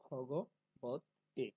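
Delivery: ভগবত এ said very slowly, syllables split. Shown as a demonstration. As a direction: break ভগবত এ apart slowly. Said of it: "এখন ভেঙে দিয়েছি এখানে". (ভেঙে ভেঙে ধীরে ভগবত এ)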